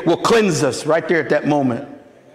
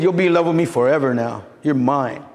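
Jesus' tender reply; 7 semitones lower than usual. Retold or imitated. Jesus answering the new believer, lower and gentle. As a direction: claim him gently as your own.

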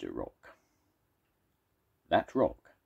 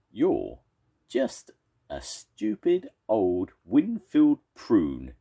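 slow, louder, higher mocking shout; shouted insult; 6 semitones higher, 6 dB louder, slower than usual. First monster shouts as he heaves a boulder. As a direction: savour every word of the insult. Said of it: "shouted the first monster".

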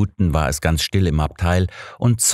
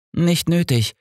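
fending off; 12 semitones higher than usual. A narrator's reading. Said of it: "wehrte ich ab".

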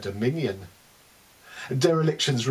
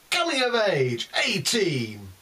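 higher bingo hall call; bingo caller; 6 semitones higher than usual. Pretend. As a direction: call the number across the hall. Bingo calls in poem.

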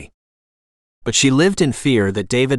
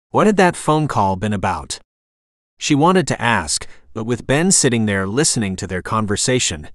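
ordinary delivery, nothing marked

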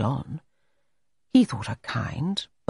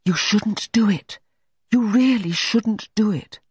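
slow, higher-pitched earnest reproach; low-toned reproach; 7 semitones higher, 3 dB louder than usual. A narrator's reading. Maisie privately warns Allegra after her playing, in earnest reproach. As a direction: press the warning slowly, pitched up. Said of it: "say in a low tone to her".

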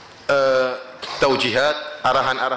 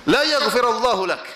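retold angry curse; 7 semitones higher than usual. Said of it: "sekarang sebaliknya yang negur marah".

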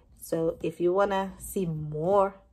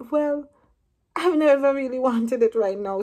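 higher, louder protest, pushing back hard; raised protest; 7 semitones higher, 7 dB louder than usual. Retold or imitated. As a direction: plead your case loudly. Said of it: "I'm like".

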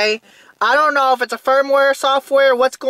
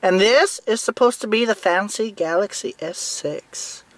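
usual speaking voice

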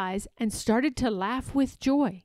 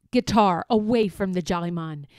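ordinary delivery, nothing marked

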